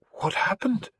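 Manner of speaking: high, horrified gasp of a question; voiced, appalled, not truly whispered. A narrator's reading. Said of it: "in an appalled whisper".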